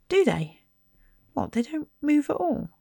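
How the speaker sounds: put-on lightly amazed boy; a touch of wide-eyed surprise. A narrator's reading. Ron amazed that Muggle photos don't move. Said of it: "Ron sounded amazed".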